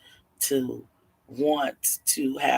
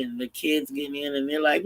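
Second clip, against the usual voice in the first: ordinary delivery, nothing marked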